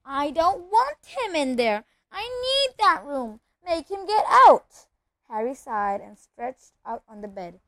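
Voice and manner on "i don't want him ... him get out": loud, high-pitched bawl; drawn-out tantrum yell at a mother. A narrator's reading. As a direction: bawl it out high and slow, building louder. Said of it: "Dudley bawling at his mother".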